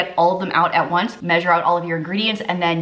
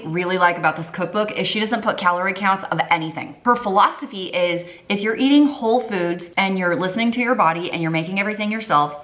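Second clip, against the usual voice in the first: ordinary talk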